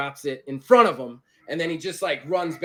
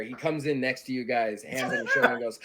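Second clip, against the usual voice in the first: normal talking voice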